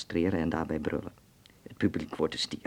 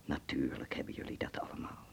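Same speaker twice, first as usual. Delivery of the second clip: a hushed whisper; 6 semitones lower, 11 dB quieter than usual. Acted. Hans hushes his Dutch friend and whispers. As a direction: breathe it so only he hears. Hushes him, then confides.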